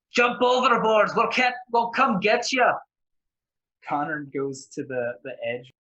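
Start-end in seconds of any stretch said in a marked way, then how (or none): 0.1-2.8 high, very loud character shout. Acted. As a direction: bellow orders across to another boat. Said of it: "Harold is just gonna shout".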